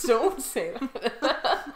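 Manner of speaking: slow, laughing "don't say that"; laughing plea. Real, not acted. Laughing, begging her friend not to say "Mum". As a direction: drag it out through laughter.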